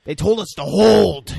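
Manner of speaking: loud, high, stretched-out protest; mock angry yell. Co-host voicing the partners' quarrel, mock angry yell. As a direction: drag out "hold" in a mock shout.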